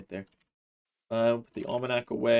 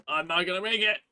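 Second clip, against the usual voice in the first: higher, panicky repeat; mock panic; 9 semitones higher than usual. Real, not acted. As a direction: ham up the panic. Racing against nightfall, repeated higher.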